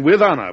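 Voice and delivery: the President insisting on his honor; emphatic. Acted. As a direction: lean hard on the word honor. President defending his decision.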